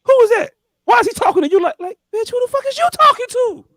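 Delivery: very high-pitched mock outraged woman; indignant insurance lady. Insurance lady asking Candace who that man is, high-pitched indignation. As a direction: mimic her affronted squeal. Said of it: "had the insurance lady telling her".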